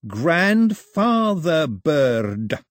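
put-on very slow, stretched baby talk. A narrator's reading. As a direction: say it like to a toddler. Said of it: "in a talking to baby's voice".

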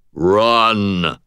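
booming machine voice; a hint of arcade game voice; resonant baritone. A narrator's reading. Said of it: "said a resonant baritone".

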